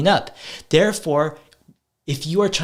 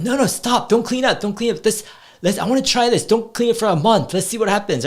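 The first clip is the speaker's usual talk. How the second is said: mock eager protest; 7 semitones higher than usual. Dad teasingly urging his kids to stop cleaning, playful high protest. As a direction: ham up begging them not to clean.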